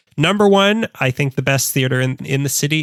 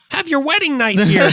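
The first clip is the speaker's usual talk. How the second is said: very high, silly salesman voice; squeaky huckster pitch; 10 semitones higher than usual. Retold or imitated. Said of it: "a high-pitched nasally voice".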